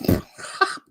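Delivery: a short unvoiced burst of laughter; breathy laugh. Real, not acted. Laughs at the hot dogs.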